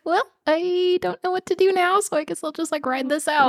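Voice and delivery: high airy shrug of a voice; high resigned voice. Retold or imitated. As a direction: lift way up, helpless.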